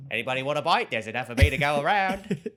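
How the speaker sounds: a hint of a greedy octopus offering itself; quoting the octopus. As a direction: ham up the monster octopus line. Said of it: "That's a line of the movie".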